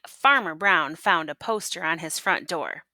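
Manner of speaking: plain reading voice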